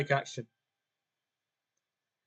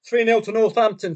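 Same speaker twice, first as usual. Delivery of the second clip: exclaiming score; 6 semitones higher, 7 dB louder than usual. Real, not acted. Bad score news.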